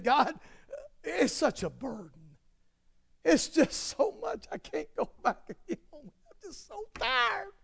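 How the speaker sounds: hammed-up weepy whine, soft and half-laughing; fake-sob to God as if the burden crushes you; mock crying moan. Mock worshippers moaning to God.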